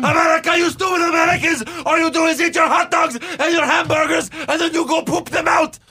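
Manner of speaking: high, loud shouted heel promo; wrestling promo. Retold or imitated. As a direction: scream it like a villain cutting a promo. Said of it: "cut a promo".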